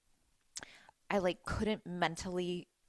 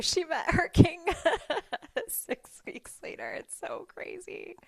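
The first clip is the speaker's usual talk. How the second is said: high breathless laugh through the words; amazed, words dissolving into laughter; 11 semitones higher, 4 dB louder, slower than usual. Real, not acted. Tells friend's story, breaks up laughing.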